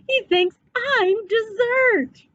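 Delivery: frightened cry. A narrator's reading. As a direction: cry out in fright as a small boy. Frightened boy.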